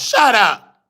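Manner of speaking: put-on high, sharp "Shut up" from an old grandma; grandma snapping. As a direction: bite it off like an irritated grandmother.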